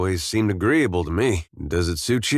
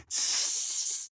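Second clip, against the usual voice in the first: soft, toneless hiss; imitated snake hiss; 9 dB quieter than usual. Man hissing to a little snake, soft imitated hiss.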